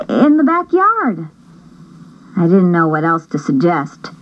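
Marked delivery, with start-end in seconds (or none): 0.1-1.3 put-on high hopeful girl voice; young girl's answer. Julie suggesting the backyard to her mom, high and hopeful. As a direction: offer it up eagerly in a high voice.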